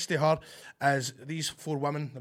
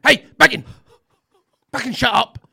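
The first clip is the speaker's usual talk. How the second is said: higher, louder mock yell from the row behind; retold shout; 7 semitones higher, 11 dB louder than usual. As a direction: bark it at the back of someone's head. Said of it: "a guy behind me went".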